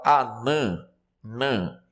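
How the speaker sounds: "anã, nan" with a nasalized A; som nasal. Shown as a demonstration. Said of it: "indica a nasalidade na vogal A". ("anã, nan" com vogal A anasalada)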